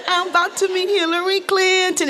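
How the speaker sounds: high, sing-song gloating chant; giddy celebration. Retold or imitated. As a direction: chant it like a victory dance. Started doing my little circle dance.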